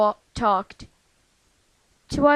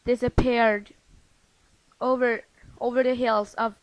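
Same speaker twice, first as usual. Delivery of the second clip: usual speaking voice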